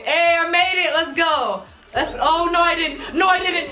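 loud, high triumph tipping into panic; celebrate, then cry out in alarm; elated yell turning frantic; excited shouting. Real, not acted. Triumph turns to panic in game.

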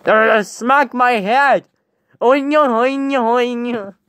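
high, drawn-out goofy babble; Crazy Dave. Acted. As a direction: babble like a dazed loon. Playing dazed Crazy Dave.

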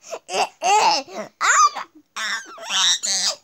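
put-on old lady voice cackle. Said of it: "Can I practice my old lady voice?".

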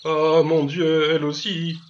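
loud, high, drawn-out exclamation of shock; stupefied cry. A narrator's reading. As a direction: cry out aghast, stretching the words. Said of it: "stupéfait". (cri stupéfait)

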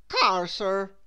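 supply the word as a helpful officer. A narrator's reading. The policeman corrects Mister Topsy-Turvy, helpfully.

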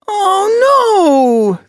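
loud, very high cry of dismay; anguished young wail. A narrator's reading. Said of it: "voice rose in a wail".

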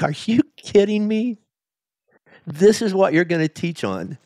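high-pitched, incredulous retort; can't-believe-this complaint; incredulous protest. Retold or imitated. Retold incredulous reaction to the sermon.

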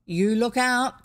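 loud warning from the crowd; crying out. A narrator's reading. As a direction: call out a sharp caution. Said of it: "cried another".

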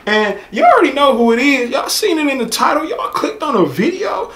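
straining a lost voice; hoarse voice. Real, not acted. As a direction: voice gone, cracking high. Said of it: "Sorry, my voice is gone".

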